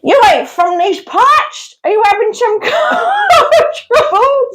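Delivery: put-on slow, high rural accent with a laugh; local yokel. Local greeting a stranded driver, hammed-up rural drawl. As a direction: ham up a suspicious country local. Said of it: "Do it again".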